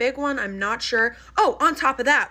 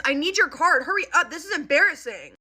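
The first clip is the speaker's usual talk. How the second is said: imitating a rude, demanding kid; higher, impatient snap at mom; bratty, embarrassed impatience; rudest tone; 6 semitones higher, 3 dB louder than usual. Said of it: "In, like, the rudest tone ever".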